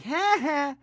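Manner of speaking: high-pitched mocking two-note laugh; Nelson. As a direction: jeer it high, like a schoolyard taunt. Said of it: "Very much Nelson, Nelson-like".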